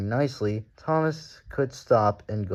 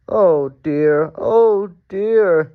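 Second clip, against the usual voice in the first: higher, loud whiny groan; groaning trucks; 9 semitones higher, 10 dB louder, much slower than usual. A narrator's reading. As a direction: moan like sorry trucks. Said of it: "they groaned".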